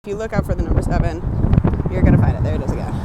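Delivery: fast emphatic restatement. Real, not acted. Repeats claim, then emphatic.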